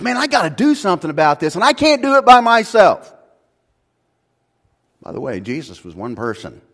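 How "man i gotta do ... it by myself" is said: loud, high, driving sermon voice; preaching at full force. Acted. Pastor to congregation, loud, passionate preaching. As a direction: hammer it home to the congregation.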